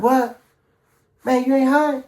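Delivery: high, loud mock disbelief; incredulous. Buddy stunned he wants another line, incredulous. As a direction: play a friend who can't believe it. Buddy reacting to request for more.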